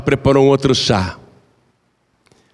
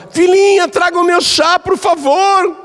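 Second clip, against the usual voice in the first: put-on calling old lady. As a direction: sing out the request from the next room. Mother-in-law calls for tea.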